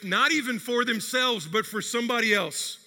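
normal talking voice

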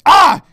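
short, high, very loud yell; exasperated scream. Real, not acted. Podcaster fed up with fiancée, exasperated scream. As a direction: explode in one frustrated "Ah!".

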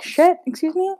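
indignant high "excuse me?"; a scandalised teacher, pitch jumping up; shocked. Acted. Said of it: "Madame Dufour was like".

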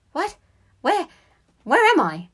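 high, loud burst on waking; startled fear. A narrator's reading. Boy waking suddenly in his porridge, acted alarm. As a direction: wake up alarmed and disoriented. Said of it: "great deal of fear in his eyes".

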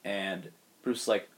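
ordinary delivery

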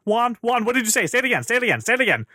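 mock-desperate, high insistent repeats; acting out a mom begging for a repeat; frantic pleading. Retold mom shaking kid awake.

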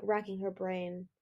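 ordinary delivery

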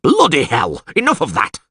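high, exasperated yell. A narrator's reading. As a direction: order the crowd to stop. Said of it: "yelled Caldermain".

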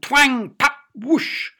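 loud, high twang, pop and whoosh; cartoonish bursts of sound; acted sound effects. A narrator's reading. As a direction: act out each noise with gusto. Sound effects of blackbird's swoop.

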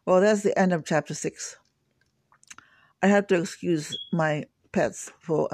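usual speaking voice

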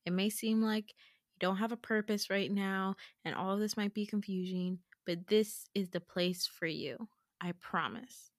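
low, soft, steady comfort; earnest reassurance. Retold or imitated. Hands on her shoulders, he promises she belongs, softly earnest. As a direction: drop the voice and sincerely reassure. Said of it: "looks me dead in the eye".

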